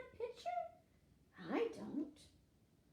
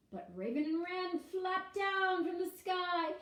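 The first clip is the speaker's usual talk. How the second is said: ordinary delivery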